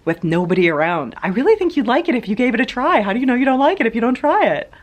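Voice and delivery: hammed-up introvert urging an extrovert to read alone; mock pushy introvert. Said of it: "envision an introvert saying to an extrovert".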